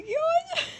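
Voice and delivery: grito agudo, bem mais alto, entre riso e choro. Real, not acted. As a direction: explode rindo e chorando de raiva. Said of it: "reage a cena triste do livro". (grito choroso de verdade)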